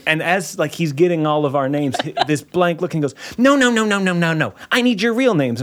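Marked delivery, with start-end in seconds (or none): 3.4-5.6 a hint of a flustered sound guy, higher pitch; mock the exasperated organizer; lightly imitated fussy organizer; impression of the sound guy. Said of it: "That's such a good impression".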